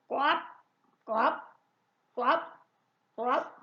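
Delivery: short hiccuping frog croaks. A narrator's reading. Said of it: "en criant comme s'il avait le hoquet". (coassements de grenouille hoquetants)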